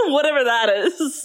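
high giggle into the words; dismissive line through a squealing laugh. Real, not acted. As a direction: break up laughing while speaking. Laughs at own question.

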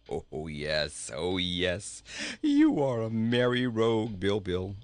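jolly king talking through laughter; breaking up with mirth; laughing. A narrator's reading. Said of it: "laughed the king".

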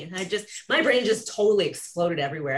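usual speaking voice

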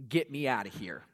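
low, soft, dragging mutter of boredom; bored groan. Retold or imitated. Bored teen imagining a Scripture talk. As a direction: sound fed up with the topic. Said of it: "you start thinking things like".

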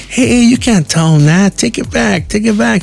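put-on high, slowish little-kid scolding. Kid telling his friend to take it back, in a high scolding kid voice. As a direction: tell him off like an outraged child.

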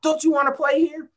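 high, strained and loud coach's rant; mock tirade of an old football coach; imitating coach yelling. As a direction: chew out a player on the field. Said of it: "He said".

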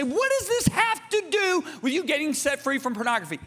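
high-pitched incredulous question; challenging objection. Retold or imitated. Voicing a listener's objection.

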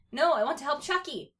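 put-on baby voice. A narrator's reading. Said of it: "Tommy said in his baby voice".

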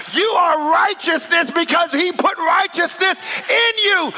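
rally the church, rising to a climax; fiery preacher proclaiming victory; full-force preaching. Acted. Preacher builds to full-force exhortation.

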